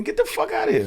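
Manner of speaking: hammed-up shout; mock yell. Ranting at guards.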